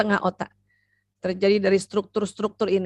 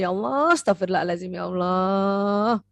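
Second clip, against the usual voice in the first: repentant prayer; 3 semitones lower than usual. Shown as a demonstration. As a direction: pray for forgiveness, stretching the last "Allah". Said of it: "kita bertobat dulu sama Allah".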